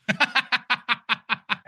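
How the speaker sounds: high, chuckling laugh; real laugh. Real, not acted. Actor amused by his own bad-day audition story, laughing. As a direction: laugh in a quick high run.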